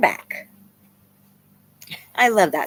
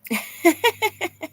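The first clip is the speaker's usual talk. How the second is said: high laugh; 11 semitones higher than usual. Real, not acted. Laughs at viewer comment.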